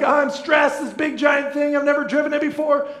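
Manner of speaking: stressed shout. Retold or imitated. Pastor relives driving an RV in Chicago traffic, panicked shout. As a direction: yell it like you're stuck in traffic. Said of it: "I'm just like".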